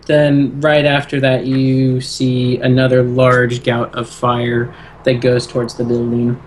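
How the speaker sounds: usual speaking voice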